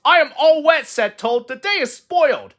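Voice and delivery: high, loud whine; glum Toad. A narrator's reading. Toad complaining to Frog that he is all wet, high whine. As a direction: moan about the rain.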